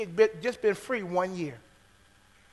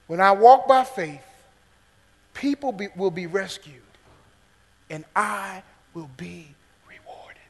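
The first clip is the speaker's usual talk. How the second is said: fading to whisper; 6 dB louder than usual. Shown as a demonstration. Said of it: "remember this main point".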